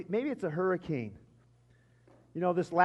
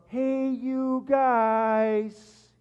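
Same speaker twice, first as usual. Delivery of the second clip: very slow, stretched, high cry; Sloth; 5 semitones higher, 4 dB louder, much slower than usual. Retold or imitated. Preacher imitates Sloth coming to help the Goonies, long drawn-out cry. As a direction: drag each word out in a long goofy holler. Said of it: "He cries out".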